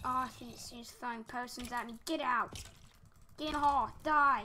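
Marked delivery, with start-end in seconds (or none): 2.1-4.4 loud battle yells; real fierce shouts. Real, not acted. Player fighting a potion-throwing witch, shouting. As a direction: bark commands at the enemy.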